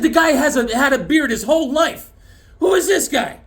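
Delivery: high and loud, building outrage; hammed-up indignant yell; mock angry outburst. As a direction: son yelling at the nurse. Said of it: "I said".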